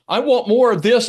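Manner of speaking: high and loud, bursting with desire; eager longing. Retold or imitated. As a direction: reach out hungrily for the beauty. Overwhelmed by the open sky.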